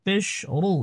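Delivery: ordinary delivery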